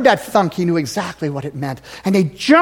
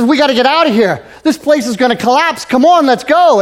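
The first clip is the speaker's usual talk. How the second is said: urgent miner; 6 semitones higher, 6 dB louder than usual. Retold or imitated. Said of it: "the other miner says".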